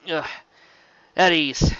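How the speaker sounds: pained sputter. A narrator's reading. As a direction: spit the order out while hurting. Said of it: "Shining sputtered".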